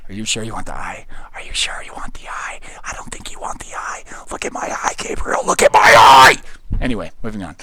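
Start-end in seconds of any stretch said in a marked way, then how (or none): 0.1-1.1 low, hushed warning; mock ominous. 1.3-4.0 hushed, ominous whispered warning; creepy whisper. Pretend. Mock ominous warning to viewer. 4.3-5.5 forced stage whisper, growing stronger; intense whisper. Pretend. Whispered build-up to a shout. 5.5-6.4 very loud, strained yell; mock menacing shout. Mock creepy demand peaks.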